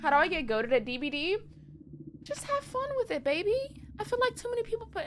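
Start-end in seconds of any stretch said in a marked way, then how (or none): 2.2-5.0 high, fast, airy advice; breezy high-pitched reassurance; cutesy advice voice. Acted. Streamer advising chat on getting good at DBD in a high, breezy put-on voice.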